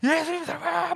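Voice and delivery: high-pitched acted exclamation; high, frenzied disciple's yell; excited shouting. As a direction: cry out the news, beside yourself. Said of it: "막 이러고 있지 않았을까?".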